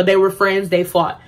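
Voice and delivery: ordinary talk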